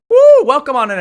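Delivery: very high, loud cheer; whoop, then call them in loudly; excited whoop. Real, not acted. Streamer welcoming everybody in from a raid, excited whoop.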